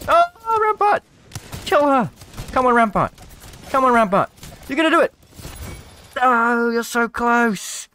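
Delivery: loud high cheer for Rampart; root for her at full volume; yelling at the screen; excited cheering. Real, not acted. Cheering on a player.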